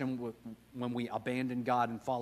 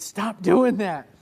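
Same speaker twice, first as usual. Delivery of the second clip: loud, slow mock scold; wife scolding; 7 semitones higher, 14 dB louder, much slower than usual. Wife scolding her husband in the car, loud and slow. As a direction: bark it out like the embarrassed wife.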